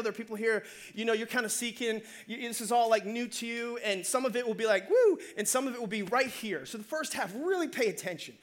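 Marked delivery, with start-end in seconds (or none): 4.9-5.2 high, loud whoosh-like "woo"; quick swooping "woo"; over-the-head "woo". Acted. Preacher shows new ideas flying past listeners, high swooping woo. Mimics message going over heads.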